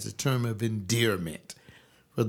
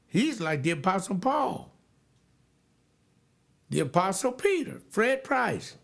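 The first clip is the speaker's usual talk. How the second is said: incredulous exclaim; 8 semitones higher than usual. Real, not acted. Mocking preacher made apostle.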